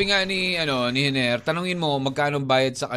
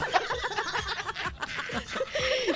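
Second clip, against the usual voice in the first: high squeaky laugh; 8 dB quieter than usual. Real, not acted. Laughs after quoting listener.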